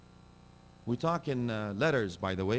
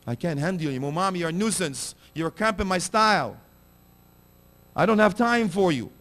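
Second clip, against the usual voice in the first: high, raised plea of a hurt mother; grieving mother; 7 semitones higher, 5 dB louder than usual. Acted. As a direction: plead as a wounded mother, voice lifted. Mother pleading with ungrateful son.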